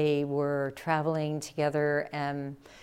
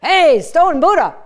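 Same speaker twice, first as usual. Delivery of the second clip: much louder, higher call; mocking shout; 10 semitones higher, 16 dB louder than usual. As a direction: yell it across the room. Calling out mockingly.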